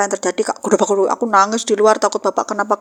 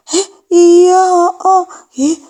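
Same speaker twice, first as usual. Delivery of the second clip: high, loud, sing-song mocking cry; mocking friend; 7 semitones higher, 7 dB louder, slower than usual. Friend mocking the mango thief, high and loud. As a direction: jeer at her like a gleeful child. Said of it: "kayak dia olok-olok gitu dia".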